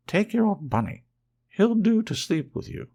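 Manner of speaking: put-on a hurried nurse dumping the bunny on the boy; loud, high, no-nonsense nanny voice; bossy nanny. Nana grabs bunny, hurried.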